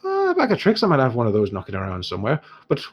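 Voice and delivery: lightly Irish dwarf, high openings; musing trader checking his stock; dwarf shopkeeper. Acted. Dwarf asks about the giant slayer arrow, lightly in character.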